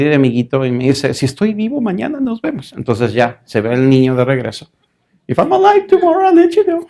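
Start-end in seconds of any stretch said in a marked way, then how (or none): 1.1-2.7 put-on boy's voice, higher; mock dread of a little boy; lightly voiced nervous kid; scared boy. 5.3-6.9 put-on very high, louder child's voice; hammed-up frightened kid; comic squeaky boy dreading his punishment; scared little boy. Boy's dread repeated in English.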